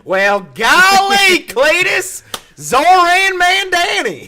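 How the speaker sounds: golly, like a hammed-up hillbilly. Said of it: "go back to my redneck roots".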